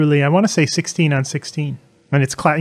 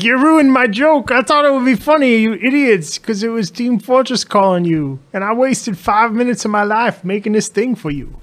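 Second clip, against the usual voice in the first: imitating Ivan; 6 semitones higher than usual. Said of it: "He's like".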